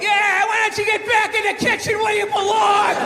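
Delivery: mock sexist heckle. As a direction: yell it out the window like a sneering heckler. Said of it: "be like".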